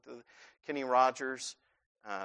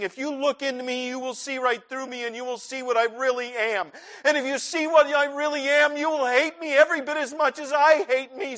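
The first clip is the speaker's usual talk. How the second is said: higher, louder desperate plea; anguished ashamed prayer; pleading with God; 7 semitones higher, 6 dB louder than usual. Retold or imitated. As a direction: beg God to look away. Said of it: "you're like".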